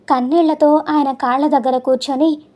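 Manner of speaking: ordinary delivery, nothing marked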